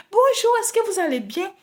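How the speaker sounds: high, sweet mock-polite hello; overly sweet shop greeting; sugary cashier greeting. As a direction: mimic the cashier's honeyed welcome.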